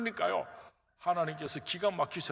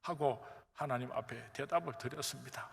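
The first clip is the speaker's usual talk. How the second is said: ordinary talk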